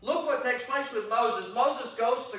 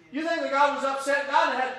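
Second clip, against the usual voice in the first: usual speaking voice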